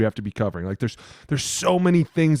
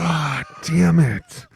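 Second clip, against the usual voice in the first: frustrated groan; 6 semitones higher, much slower than usual. Retold or imitated. Player reacting to blank grid.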